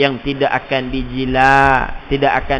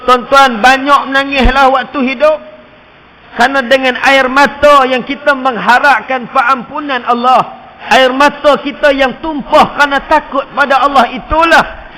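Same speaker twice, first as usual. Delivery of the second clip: high, loud sermon exhortation; impassioned preaching; fiery preaching; 9 semitones higher, 8 dB louder than usual. Acted. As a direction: urge the crowd to weep. Preaching at full force.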